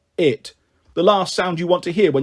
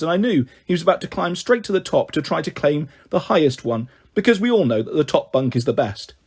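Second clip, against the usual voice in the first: ordinary delivery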